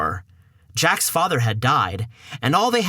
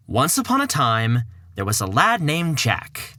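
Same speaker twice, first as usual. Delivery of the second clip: normal talking voice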